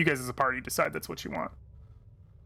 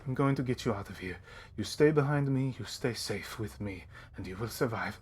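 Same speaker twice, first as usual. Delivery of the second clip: low, hushed, steady reassurance; consoling; 4 semitones lower, 7 dB quieter than usual. Acted. Captain promising the merchant a way out, consoling. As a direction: drop soft and low to promise protection. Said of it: "consoling him".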